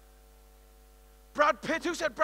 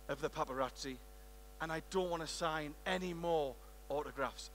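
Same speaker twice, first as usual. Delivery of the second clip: a hint of a lower, softer Clooney on the phone; lightly voiced George Clooney; 6 semitones lower, 12 dB quieter than usual. Star phoning the shop owner, a lightly voiced, softer Clooney. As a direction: quietly play a star asking a favour. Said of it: "He picks up the phone".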